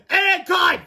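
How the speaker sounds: high, loud yell; acted high-pitched scream; exasperated scream. A narrator's reading. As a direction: shout it in urgent frustration. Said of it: "screamed Fluffy".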